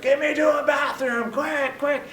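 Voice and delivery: high, urgent mock scream; mock-frantic high demand; retold screaming. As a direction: blurt it out high, imitating a desperate woman. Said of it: "screaming at the top of her lungs".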